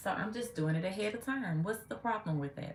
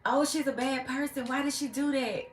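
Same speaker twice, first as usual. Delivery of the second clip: high scandalized mock of the critics; high mock gasp of the critics; 9 semitones higher, 4 dB louder than usual. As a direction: squeal it out like a gossip. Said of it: "and then when I do it".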